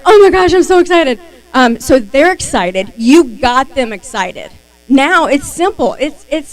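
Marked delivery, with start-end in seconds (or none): 0.0-1.2 higher and louder, gushing excitement; thrilled squeal. Retold or imitated. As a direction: gush like a thrilled winner. Acting the thrilled winner.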